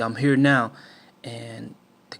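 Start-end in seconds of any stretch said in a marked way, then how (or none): 0.0-0.7 loud, threatening bark; menacing shout. Retold or imitated. Gunman threatening the boys from the car, loud menacing shout.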